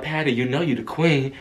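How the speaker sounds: low, soft imitated compliment. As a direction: pay quiet tribute, voice dropped. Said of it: "[name] walks over to [name] like".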